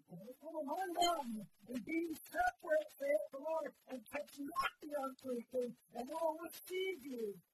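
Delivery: loud, high, fervent preacher's cry; full-force preaching. Real, not acted. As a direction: preach it out over the whole church.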